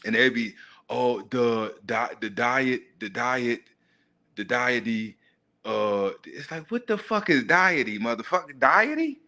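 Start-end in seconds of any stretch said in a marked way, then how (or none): 0.9-6.1 slow, stumbling attempts with pauses between tries; halting misreading. Man mimicking people reading a hard word, halting and unsure. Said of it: "then they gotta read it. And they'd be". 6.7-9.2 high-pitched disbelief, jumping up on the last word; baffled, rising exclamation; incredulous. Acted. Man reacting to a botched word, incredulous.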